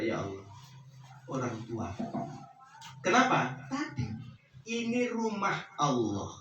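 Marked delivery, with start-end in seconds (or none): 0.0-1.9 soft, hushed whisper of prayer; quiet prayer. Shown as a demonstration. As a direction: murmur it like a private plea to God. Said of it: "itu sambil berdoa". (doa pelan dan lirih)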